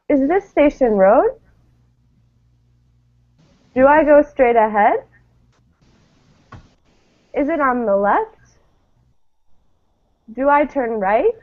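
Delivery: each question lifting up at the end; rising yes-no questions. Shown as a demonstration. Teacher modeling rising question intonation for students. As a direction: read the questions with a clear rise at the end. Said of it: "just listen to me read these".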